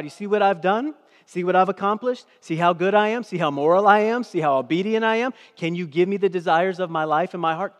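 loud, high, boastful pleading; mock bargaining believer. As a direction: show off your good deeds to God. Mocking a bargaining moralist.

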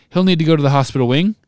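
loud and fast official insistence; loudly. A narrator's reading. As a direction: push it out over the crowd, hurried. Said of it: "Fudge was saying loudly".

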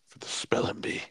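hushed whisper. Acted. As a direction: whisper it conspiratorially. Joking spelling bee rule.

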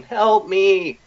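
high, drawn-out mock cry for help; imitated victim's cry. Podcaster retelling the bear stealing her voice, imitated high cry. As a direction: lightly imitate a bear wailing in a dead woman's voice. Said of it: "the bear is growling in her voice".

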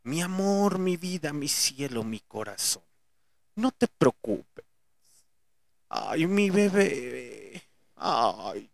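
put-on slow, cooing baby talk; mock baby talk. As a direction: coo at them like a pampered baby. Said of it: "les soben el lomito y les digan".

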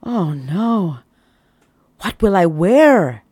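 a hammed-up sudden horror; horrified. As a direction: panic about his clothes. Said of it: "a look of absolute horror".

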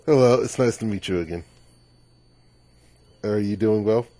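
ordinary talk

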